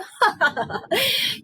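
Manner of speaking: high mischievous giggle; a naughty boy's snicker; sly laugh. Acted. Ram laughs about his excuses, sly giggle.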